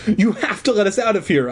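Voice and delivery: high-pitched, insistent demand. A narrator's reading. Trapped kid demanding to be let out, insistent. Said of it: "I insisted".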